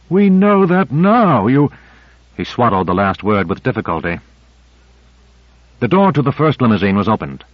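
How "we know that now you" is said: loud, slow, high shout cut off. Acted. Gonder shouting at Owens after the crash, loud and slow. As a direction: snap it out then choke on the last word. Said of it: "Gonder shouted".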